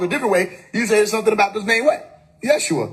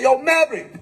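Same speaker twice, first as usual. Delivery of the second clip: mild call out; 6 semitones higher than usual. Retold or imitated. Calling across the room.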